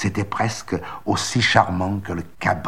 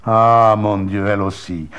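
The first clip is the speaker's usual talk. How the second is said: louder, dismayed exclamation; stunned cry; 7 dB louder than usual. Acted. Monsieur Seguin crying out, stupefied by his goat. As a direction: cry out in shock. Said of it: "cria monsieur Seguin stupéfait".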